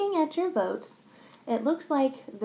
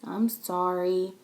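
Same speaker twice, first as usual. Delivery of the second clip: nice voice; 4 semitones lower, slower than usual. Acted. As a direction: say it gently. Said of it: "in a nice voice".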